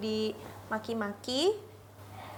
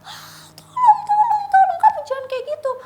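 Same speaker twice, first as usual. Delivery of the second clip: high, loud, crying cry of tolong; panicked scream for help; 9 dB louder than usual. Retold or imitated. Begging the raging boyfriend to stop, loud high crying scream. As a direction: scream in terror as he threatens to throw things. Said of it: "Aku langsung teriak".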